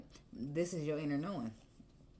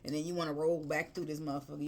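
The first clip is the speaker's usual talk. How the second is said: usual speaking voice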